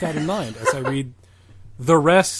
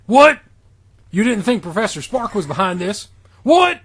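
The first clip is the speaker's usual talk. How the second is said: baffled shout; 7 semitones higher, 9 dB louder than usual. Acted. Acted baffled outburst in fanfic reading.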